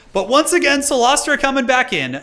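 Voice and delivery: high, loud, slow exasperation; exasperated exclaim. Real, not acted. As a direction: groan out the bad news loudly. Exasperated at enemy returning.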